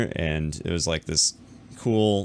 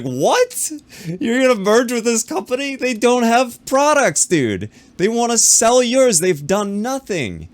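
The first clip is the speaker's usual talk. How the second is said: much higher, louder, disbelieving shout; shrill retold outcry, much higher and louder; incredulous yell; 14 semitones higher, 7 dB louder than usual. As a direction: yell in outraged disbelief at the news. Retold outburst at friend's news.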